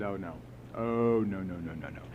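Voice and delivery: low, soft string of noes; dismayed refusal. Retold or imitated. Sponsor after hearing one resentment read, quietly dismayed. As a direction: imitate quiet, sinking dismay.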